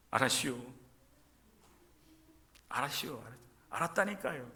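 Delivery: mock Chungcheong reluctant agreement; Chungcheong drawl. Chungcheong local brushing off a favor, mild mock drawl. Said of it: "이래요".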